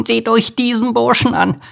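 very high, tittering cricket voice; giggling cricket. A narrator's reading. Rough cricket mocking Bodo, giggling. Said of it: "kicherte eine raubeinige Grille".

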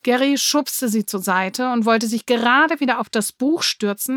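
ordinary delivery, nothing marked

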